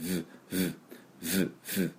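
V as a soft buzzing consonant, no full vowel; isolated V sound. Shown as a demonstration. Teacher isolating the first sound, soft buzzing V. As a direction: sound V alone, quietly, as a short voiced buzz. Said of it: "Vですから".

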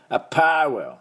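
English アパレル, strong パ, light レ; stress on the pa. Shown as a demonstration. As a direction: punch the パ in アパレル. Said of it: "アパーのとこ強く".

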